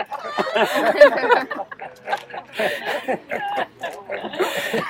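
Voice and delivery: real quiet high-pitched giggle; real giggle at a cute memory; soft high giggle. Real, not acted. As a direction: giggle softly with delight. Laughing at own story.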